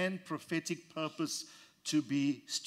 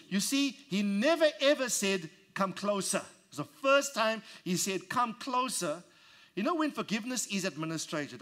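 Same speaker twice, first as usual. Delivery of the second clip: raised, impassioned preacher building; preaching at full force; 4 semitones higher than usual. Acted. Preacher stirring the congregation, impassioned full force. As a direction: preach it out, voice climbing and pushing.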